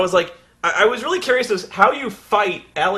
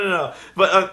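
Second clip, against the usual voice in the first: ordinary talk, nothing marked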